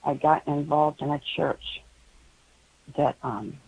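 ordinary talk

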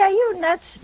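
high-pitched, acted disbelief; mock incredulity. Objector questions the preacher's teaching, in mock disbelief.

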